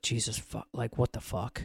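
low-volume exasperated swearing; pissed-off muttering. Retold or imitated. Startled parent called away by partner, pissed-off muttering. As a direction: curse under the breath, softly. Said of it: "Like, I was so pissed".

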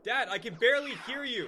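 calling from far away. Acted. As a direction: yell up to another floor. Shouting between floors.

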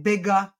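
"bigger" as big-uh, an extra uh after g; intrusive schwa. Shown as a demonstration. Therapist imitating a child she evaluated, schwa added in "bigger". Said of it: "he's adding in the uh sound".

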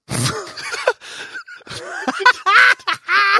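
high, loud laughing shriek. Real, not acted. High shrieking laugh at having no knees or elbows. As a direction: burst into a crying laugh.

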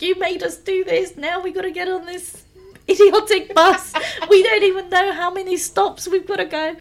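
high, exasperated rant, retold; hammed-up frantic scolding; mock shouting. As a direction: imitate a friend complaining at a high pitch. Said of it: "shouting and screaming at me constantly".

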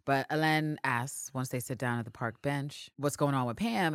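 ordinary delivery

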